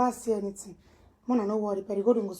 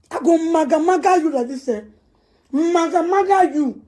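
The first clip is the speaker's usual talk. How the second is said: angry yell; 6 semitones higher, 9 dB louder than usual. Real, not acted. Shouted threat, still raging.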